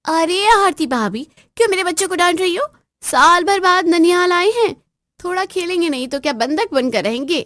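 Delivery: high, loud indignant scolding; angry sister-in-law. Acted. Sister-in-law defending her children, loud high angry scolding. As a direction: tear into her for scolding the kids.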